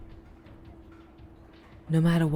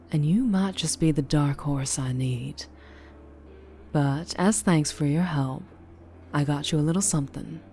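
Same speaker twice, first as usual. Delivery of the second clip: ordinary talk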